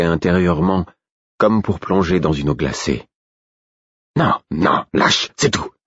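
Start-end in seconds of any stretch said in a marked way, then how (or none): 4.2-5.7 higher, insistent correction; urging inner voice. A narrator's reading. Wolf corrects the narrator's attempt, insistent urging. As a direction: coax insistently, pitched up. Wolf urges him to let go.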